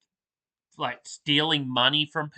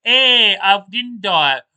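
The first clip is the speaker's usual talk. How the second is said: loud mock retort; 6 semitones higher, 9 dB louder, slower than usual. Dead man snaps back at the cops, loud and high. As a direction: shout it high, like a cheeky comeback. Mock reply in acted exchange.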